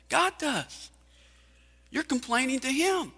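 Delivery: high, insistent preacher cadence; preaching at full force. Acted. Preacher confronting the congregation about complaining, high and insistent. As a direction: hammer the rhetorical questions home.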